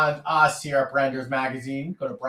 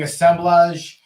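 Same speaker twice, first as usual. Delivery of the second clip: Assemblage with a light European accent, ending in -blahzh; 3 semitones higher, 6 dB louder, slower than usual. Real, not acted. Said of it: "put a European accent on it".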